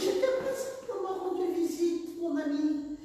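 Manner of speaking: high thin imitated voice of the other pastor; small voice. Retelling the offended pastor's complaint, in a high small voice. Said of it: "Il avait une petite voix".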